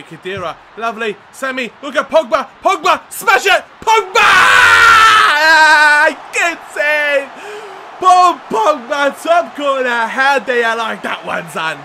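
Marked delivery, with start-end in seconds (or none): 2.6-11.8 high, loud scream easing into gloating; full-throated celebration; ecstatic goal shouting. Real, not acted. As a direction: yell it in triumph. Long-range goal celebrated.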